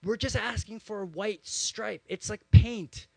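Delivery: high-pitched indignant rant, imitated; friend's rant. Pastor imitating a friend up in arms about bike lanes, indignant rant. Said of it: "he's gone this rant".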